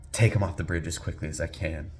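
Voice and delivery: usual speaking voice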